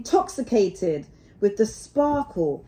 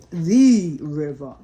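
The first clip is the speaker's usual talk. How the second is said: THE river, slow and lower; corrective stress; 5 semitones lower, 6 dB louder, slower than usual. Acted. Rat corrects Mole, stressing "The" slowly. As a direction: correct him gently, leaning on "The". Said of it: "corrected the rat".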